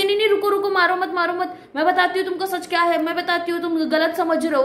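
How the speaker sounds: high-pitched, hurried mock plea; frantic pleading. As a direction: imitate a wife urgently begging him to stop. Wife stopping husband's beating in retold story.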